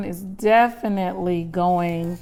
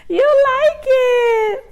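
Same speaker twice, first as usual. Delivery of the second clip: much higher, slow, drawn-out cooing; 9 dB louder, much slower than usual. Real, not acted. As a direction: gush delightedly at the dog. Dog sticks tongue out.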